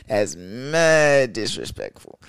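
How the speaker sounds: "That's mad disrespectful" stretched out slowly, leaning on "mad"; slow emphatic repeat. Shown as a demonstration. Repeating the line slowly to the crowd, emphatic. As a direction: drag it out to hammer it home. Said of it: "repeats it slowly".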